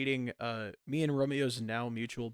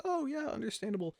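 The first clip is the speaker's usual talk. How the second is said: mock understanding; 11 semitones higher than usual. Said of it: "And I'm like".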